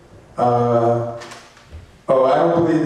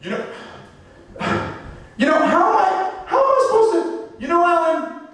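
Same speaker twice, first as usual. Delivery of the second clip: very high, fast, exasperated whining at Alan; a flustered inventor squealing complaints at his helper; shrill panicked falsetto rant about the mess; frantic vacuum inventor; 14 semitones higher than usual. Acted. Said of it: "this is my impression of the guy who invented that vacuum cleaner".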